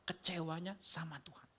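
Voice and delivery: whispered reveal; hushed whisper. Acted. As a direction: drop to a whispered aside. Preacher hushes the key point.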